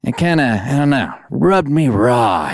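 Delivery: ordinary talk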